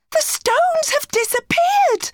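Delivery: high panicked call; alarmed shout. A narrator's reading. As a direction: cry out that the way back is gone. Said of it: "she shouted".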